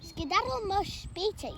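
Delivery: tough alley cat. Acted. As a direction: snap it at the newcomer. Alley cat shooing stranger.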